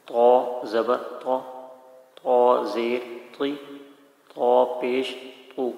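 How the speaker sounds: "طا زبر طا" very slowly, each part separate; slow Qaida drill; slow clear spelling. Shown as a demonstration. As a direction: sound out "طا زیر طی" carefully. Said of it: "واضح ہونا چاہیے".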